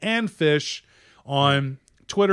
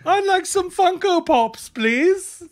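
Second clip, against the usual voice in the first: very high, hammed-up British voice; British accent; 3 dB louder, slower than usual. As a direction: order politely in a squeaky mock British accent. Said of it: "episode with a British accent".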